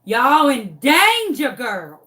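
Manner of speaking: loud slow warning, dropping on the last word; sassy dog warning. Retold or imitated. Dog warning the chickens, slow and sassy. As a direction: warn them as a vengeful dog. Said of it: "because she was like".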